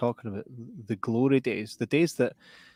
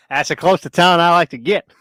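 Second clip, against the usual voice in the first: loud high hillbilly voice; loud high yokel voice; 6 semitones higher, 9 dB louder than usual. Acted. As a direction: bark it like a grumpy yokel. Acted yokel.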